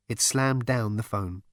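plain reading voice

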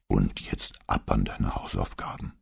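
low mock-gruff growl; growled deep bass. A narrator's reading. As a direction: send the child off in your deepest bass. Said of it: "knurrte er im tiefsten Bass". (im tiefsten Bass geknurrt)

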